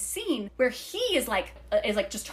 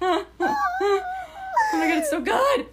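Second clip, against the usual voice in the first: laughing shout; 5 dB louder than usual. Real, not acted. Laughing fit after reading.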